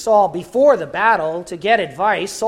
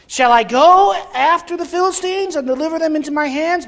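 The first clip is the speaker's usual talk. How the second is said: Saul asking; 10 semitones higher, 5 dB louder than usual. Acted. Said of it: "Saul asked counsel of the Lord".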